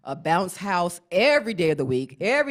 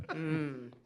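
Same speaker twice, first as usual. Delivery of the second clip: normal talking voice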